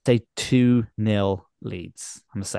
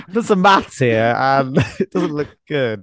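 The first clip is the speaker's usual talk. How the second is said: laughing; 4 semitones higher, 4 dB louder, slower than usual. Real, not acted. Laughing while admitting bad maths.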